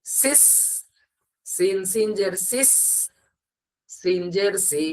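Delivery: "সিস" with a long sharp hissed s; whistling s. Shown as a demonstration. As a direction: draw out the final স in "সিস" like a whistle. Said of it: "একটা সিটির ন্যায় আওয়াজ হবে".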